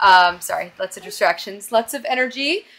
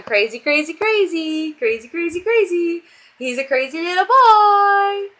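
gleeful coo that climbs and stretches on the last word; 8 semitones higher, 5 dB louder than usual. Real, not acted. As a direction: tease a little one in rising sing-song. Playful baby talk to her toddler.

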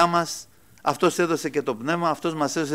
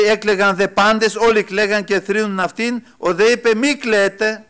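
louder preaching read; raised scripture reading; 6 semitones higher, 6 dB louder than usual. Acted. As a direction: proclaim the gospel words to the church.

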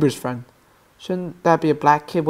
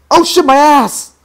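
high, loud yelp of alarm; startled yell; 10 semitones higher, 7 dB louder than usual. Real, not acted. Gamer reacting to stabbing his own character, startled yell. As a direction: cry out as if hurt. Gets hurt in game.

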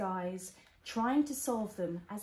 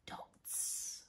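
breathy whispered word; whispering; 13 dB quieter than usual. Acted. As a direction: whisper it softly.

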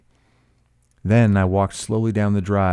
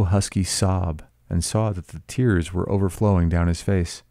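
usual speaking voice

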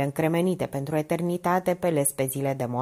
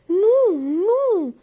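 high loud crying no; tearful high panicked no; panicked refusal; 6 dB louder than usual. A narrator's reading. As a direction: beg her not to go. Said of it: "scutură violent bigudiurile".

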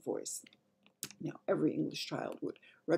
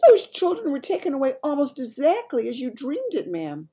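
high, loud, urgent maid; agitated maid; 10 semitones higher, 8 dB louder than usual. Acted. Maid linking news to Queen's dream, agitated. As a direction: press the point urgently.

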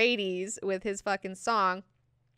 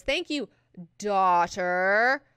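condescending burn; 5 dB louder than usual. Acted. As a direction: put her in her place, leaning hard on "daughter". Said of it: "the second burn".

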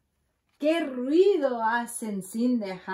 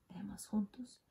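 whispered aside; 12 dB quieter, faster than usual. Real, not acted. Reader whispering to the children to read along.

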